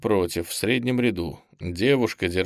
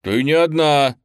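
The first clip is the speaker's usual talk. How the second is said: loud, higher-pitched annoyed retort; disgruntled; 6 semitones higher, 7 dB louder than usual. A narrator's reading. Lyonya reminding the girl he is there, disgruntled. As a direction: correct them with open displeasure. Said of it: "недовольно буркнул Лёня".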